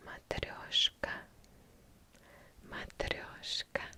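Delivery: soft, breath-only whispered repetition; ASMR whisper. Acted. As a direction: whisper the word over and over, savouring each sound. ASMR repetition of a Russian word.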